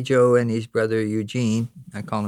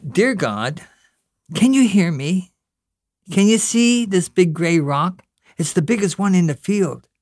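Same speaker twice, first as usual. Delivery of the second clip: put-on high, earnest little boy talking to God; a child pleading, all wide-eyed; boy at his first real prayer; young boy Pat praying; 7 semitones higher, 4 dB louder than usual.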